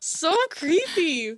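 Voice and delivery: high, eerie drawn-out creepy; mock spooky voice. Telling her friend the nightmare is creepy in a high mock-ghostly voice. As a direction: play up the creepiness like a haunted-house ghost. Reacting to a creepy dream story.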